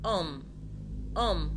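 أم begun straight on the vowel, no H; French أم with silent H. Shown as a demonstration. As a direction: say أم with the H fully dropped. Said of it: "ما نطقتش القش خالص".

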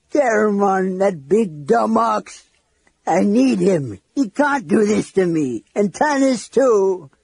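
high, drawn-out tearful lament; crying. A narrator's reading. Flint grieving his lost friends, crying. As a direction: wail it slowly through tears. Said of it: "The dwarf began to cry".

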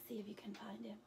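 soft playful whisper; whispered invitation. Real, not acted. Reader asking children to find Elmer in the picture, whispering. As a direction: invite them to search, hushed. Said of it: "invites children, whispered".

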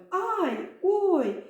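high little yelp ay, oy; playful ouch; mock cry of pain. A narrator's reading. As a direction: squeal it like a startled letter. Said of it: "кричить буква «Й»".

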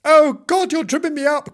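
high, loud outcry; exasperated cry. Acted. Dog tripped by kitten between legs, crying out. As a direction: cry out while being tripped. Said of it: "cried Nobs".

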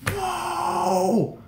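very high, long-drawn marvel; disbelief. Retold or imitated. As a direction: let one word float way up in amazement. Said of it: "I couldn't believe it".

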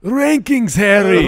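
hammed-up old headmaster calling Harry; mock Dumbledore. Host riffs on his own misread, as a mock Dumbledore. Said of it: "I went to Dumbledore".

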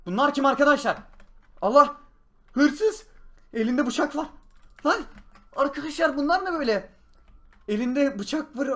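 high, loud alarmed shouting; panicked. Acted. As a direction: panic at the intruders. Thief with knife in house.

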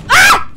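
startled scream. Real, not acted. Horror jump scare.

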